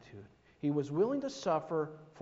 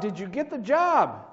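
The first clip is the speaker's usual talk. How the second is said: raised voice calling after a runner; 6 semitones higher, 7 dB louder than usual. Acted. A man calls after running Sam, louder. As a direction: call the question out after him. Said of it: "And the man called out".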